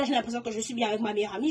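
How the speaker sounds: usual speaking voice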